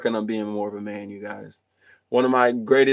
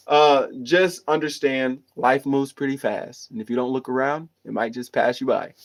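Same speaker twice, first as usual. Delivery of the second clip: ordinary delivery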